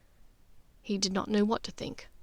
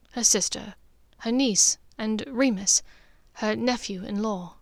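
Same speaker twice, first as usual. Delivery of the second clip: usual speaking voice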